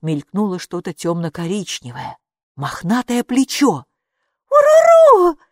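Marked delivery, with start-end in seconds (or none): 4.5-5.4 high loud shout of joy; joyful cry. A narrator's reading. Girl seeing the creature return, shouting happily. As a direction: cry out her name in delight. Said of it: "выдохнула Майки".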